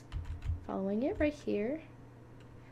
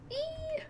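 high squeal; 6 dB quieter than usual. Real, not acted. Squeals as characters die.

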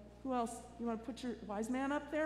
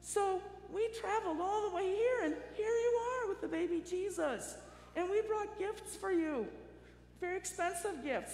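wise men voice; 8 semitones higher than usual. Retold or imitated. Wise men answer in story.

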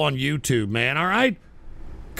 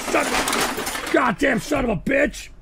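furious cursing; 9 semitones higher than usual. Real, not acted. Host cursing after jail prank.

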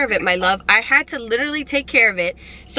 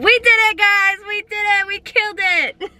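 high, excited celebration; elated, drawing out the finish; triumphant; 5 semitones higher than usual. Real, not acted. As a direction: cheer the win to the viewers. Celebrating the successful prank.

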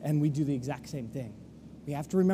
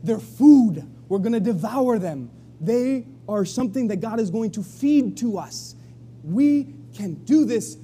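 loud, emphatic declaration; bold confidence; 3 semitones higher, 6 dB louder than usual. Retold or imitated. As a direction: punch the first word, then build courage. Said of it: "Joshua and Caleb said".

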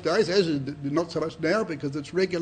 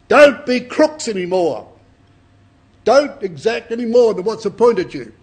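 stern command; 4 semitones higher, 9 dB louder than usual. Acted. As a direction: lay down the law to the crooks. John commands the tax gatherers.

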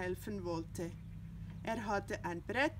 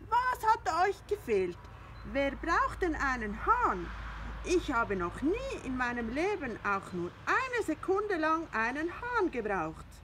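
indignant cat Findus; 7 semitones higher than usual. Acted. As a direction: scoff at the very idea of a rooster. Findus scoffs at the hens.

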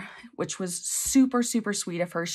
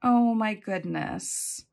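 slow, drawn-out oh my goodness; delighted gasp; much slower than usual. Real, not acted. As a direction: savour the surprise, stretching it out. Unboxing a heartfelt gift.

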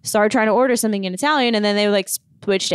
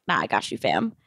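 much lower and softer, laid-back; cool casual; 11 semitones lower, 7 dB quieter than usual. Retold or imitated. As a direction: drop the voice, cool and easy. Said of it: "and I'm like".

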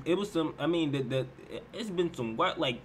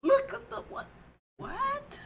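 high, stunned what; can't believe what he heard; stunned disbelief; slower than usual. Real, not acted. Disbelief at a lyric.

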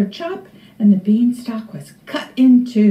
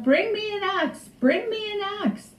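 very high, quick, softer cry; Jack calling out; 10 semitones higher, 5 dB quieter than usual. A narrator's reading. Jack needs an axe from his mother, urgent high call. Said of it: "he called out".

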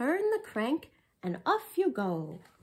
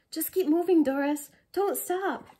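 urgent loud call; 4 semitones higher, 6 dB louder than usual. Acted. Kids urging each other on.

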